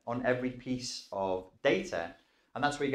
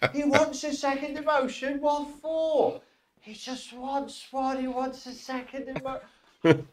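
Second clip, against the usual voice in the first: very high, loud, exasperated clerk voice; Monty Python clerk; 14 semitones higher, 4 dB louder, slower than usual. Acted. Clerk complaining about a second emotion, very high and exasperated. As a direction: ham it up like a squeaky Python bureaucrat.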